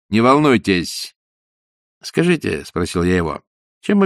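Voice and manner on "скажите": ordinary delivery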